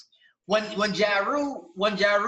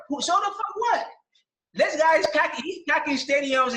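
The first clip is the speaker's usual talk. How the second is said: indignant outburst; 6 semitones higher than usual. Real, not acted. Peak of his rant.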